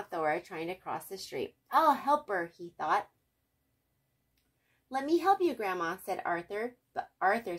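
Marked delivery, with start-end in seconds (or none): 1.7-2.5 eager. A narrator's reading. Arthur eager to help. 4.9-6.0 high, loud, eager; eager offer. A narrator's reading. Arthur offering to help Grandma Thora across, eager.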